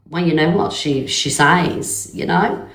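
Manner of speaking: ordinary talk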